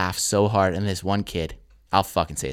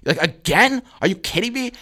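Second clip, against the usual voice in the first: high-pitched retold outrage; exasperated teacher; 10 semitones higher than usual. Teacher catching a student laughing, exasperated and high. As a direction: shoot up high on each incredulous question. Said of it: "And she goes".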